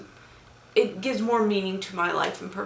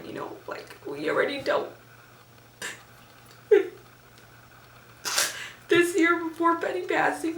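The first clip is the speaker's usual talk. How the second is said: crying; 8 semitones higher than usual. Real, not acted. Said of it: "Enough of my crying".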